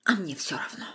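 hushed, resigned whisper. A narrator's reading. Anya tells Vasya she doesn't care, whispering. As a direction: whisper it, beaten.

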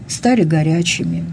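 plain reading voice

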